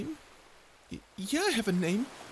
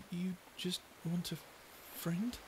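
normal talking voice

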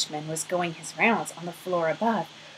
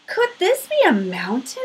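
higher, eager wonder; a small bear amazed at the escalator; 9 semitones higher, 4 dB louder than usual. Acted. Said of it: "he wondered".

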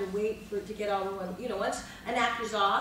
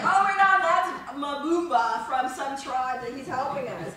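casual explanation; 3 semitones higher, 7 dB louder than usual. Retold or imitated.

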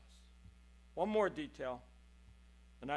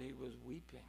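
much softer, almost breathed; hushed; 4 semitones lower, 10 dB quieter than usual. Shown as a demonstration. Preacher to the congregation, hushed and grave. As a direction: let it fall to a hush. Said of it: "when I looked close".

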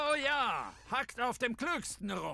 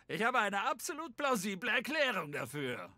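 usual speaking voice

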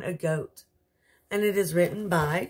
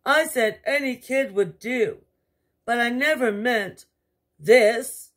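lightly voiced upset king; 7 semitones higher, 7 dB louder than usual. King laments the goat.

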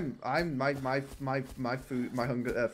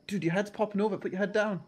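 urgent warning; 6 semitones higher than usual. Real, not acted. Warning exposed teammate.

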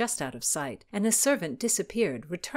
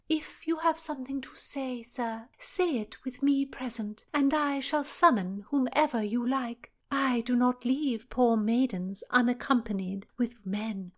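haughty princess; 6 semitones higher than usual. Acted. Cecilia firm.

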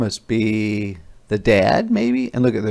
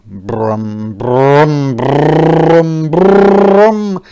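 engine revving; 2 semitones higher, 7 dB louder, much slower than usual. Acted. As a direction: rev up like an old car. Car engine starting up.

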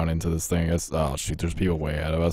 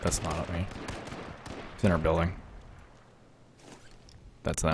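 hushed while hiding; 11 dB quieter than usual. Real, not acted. Enemy in the building, hiding.

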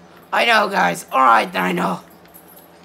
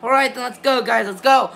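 ordinary talk

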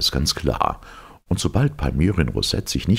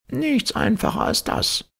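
high, slow professor voice; professor cutting in; slower than usual. A narrator's reading. Professor interrupting the captain, high dismissive voice. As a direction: cut in with a high character voice. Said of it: "fiel ihm Palmyrin Rosette ins Wort".

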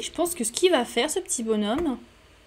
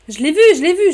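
taquinerie excitée; 5 semitones higher, 10 dB louder, faster than usual. Real, not acted. Taquine en chantonnant.

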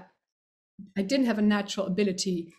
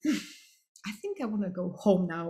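tired; 4 semitones lower, 5 dB quieter than usual. Retold or imitated.

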